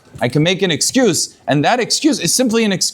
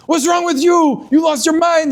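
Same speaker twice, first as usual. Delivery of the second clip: imitated outburst, pitch shot way up; mock scream; 10 semitones higher, slower than usual. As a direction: berate someone in a hammed-up high voice. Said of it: "Somebody screams at him".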